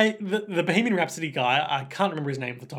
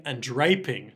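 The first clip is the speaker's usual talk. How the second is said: ordinary delivery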